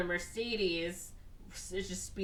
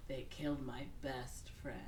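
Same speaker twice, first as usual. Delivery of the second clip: mock gasp of shock; 5 semitones lower, 11 dB quieter than usual. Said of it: "And he's like".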